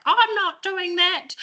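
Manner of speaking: high, slow, stubborn refusal; a grumpy doctor digging in; reluctant GP. Retold or imitated. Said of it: "a G P in our practice that's like".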